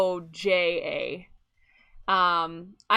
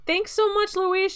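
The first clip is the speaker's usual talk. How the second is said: high cheery thanks; 12 semitones higher, 4 dB louder than usual. Real, not acted. Thanking stream chat.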